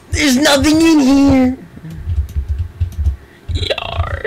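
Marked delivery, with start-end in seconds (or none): 0.1-1.6 high, slow pirate call; hammy pirate shout. Acted. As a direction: boom it out like a cartoon pirate. Pirate fox character voice.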